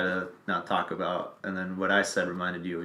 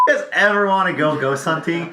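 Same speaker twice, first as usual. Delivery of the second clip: excited pitch; 8 semitones higher, 7 dB louder than usual. Real, not acted. Hyped pitch to the listeners.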